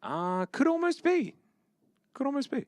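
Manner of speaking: high, slow silly voice. Pretend. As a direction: pitch it way up playfully. Playful high echo.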